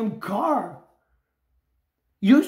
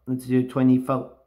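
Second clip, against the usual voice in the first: ordinary talk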